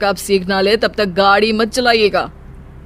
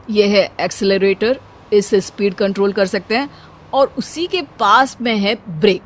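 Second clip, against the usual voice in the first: ordinary talk, nothing marked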